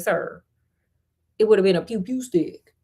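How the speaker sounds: plain reading voice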